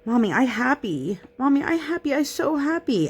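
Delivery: put-on high toddler voice insisting through tears; imitating crying toddler. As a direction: mimic a little girl pretending she's fine. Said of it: "she just kept saying to me with tears streaming down her face".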